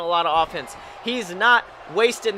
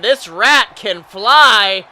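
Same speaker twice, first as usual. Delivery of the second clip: slow booming announcer call; ring announcer; 9 dB louder, much slower than usual. Acted. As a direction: drag it out for the crowd. Announcer hyping the crowd.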